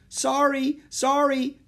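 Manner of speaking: high, loud, hurried-crowd apology; yelled apology. A narrator's reading. Boy on rampaging elephant to shoppers, yelled apology. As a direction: yell apologies out to passers-by. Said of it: "Jack kept shouting".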